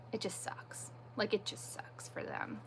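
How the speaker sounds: hushed, breathy whisper of sorrow. Real, not acted. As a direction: let it drop to a sad whisper. Sad about her kids' family.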